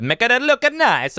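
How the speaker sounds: put-on slow hammy Italian accent on make it look nice; playful Italian accent. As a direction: stretch it out like a mock Italian chef. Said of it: "I don't know why I did that Italian".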